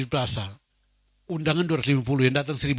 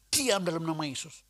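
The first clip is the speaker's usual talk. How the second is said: softer, firm command in Jesus' name; quiet rebuke; 3 semitones higher, 6 dB quieter than usual. Retold or imitated. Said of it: "dari mana duitnya". (teguran pelan dalam nama Yesus)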